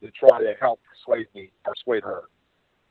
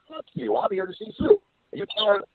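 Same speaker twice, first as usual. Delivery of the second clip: much higher, mock defiant yell; retold angry retort. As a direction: fling it back, pitched way up. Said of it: "I said".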